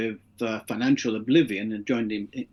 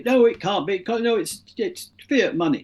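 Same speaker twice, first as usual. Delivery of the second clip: higher, lightly voiced economist objecting; conventional economists; 7 semitones higher than usual. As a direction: mimic economists dismissing the idea, pitched up. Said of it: "this is what conventional economists will tell you".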